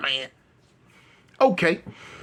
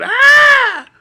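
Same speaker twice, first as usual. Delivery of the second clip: high, loud yelp of pain; pained holler; 12 dB louder than usual. Retold or imitated. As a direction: cry out as if knocked down. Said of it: "and hollered".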